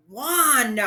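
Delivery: loud stern rebuke of the name; a father cutting his son off; sternly. A narrator's reading. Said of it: "Papa said sternly".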